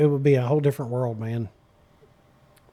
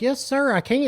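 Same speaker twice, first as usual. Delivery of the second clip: mock formal; 9 semitones higher than usual. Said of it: "I kept saying "sir".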